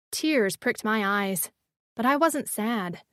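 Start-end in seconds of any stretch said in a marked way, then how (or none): none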